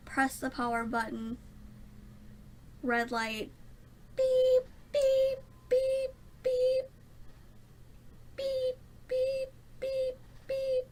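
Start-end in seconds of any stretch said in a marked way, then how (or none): 4.2-10.9 high, sharp mock beeps in pairs; imitated computer beeps. Imitating her computer's beep code to viewers. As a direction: copy the error beeps of the PC. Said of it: "Four beeps".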